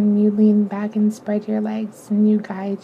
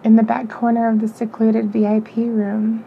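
ordinary delivery

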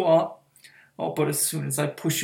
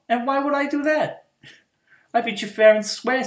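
higher and louder, taunting; mocking refusal; 9 semitones higher, 9 dB louder, faster than usual. Mistress refusing to release her pet, loud taunting. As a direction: crow it at him, brisk and smug.

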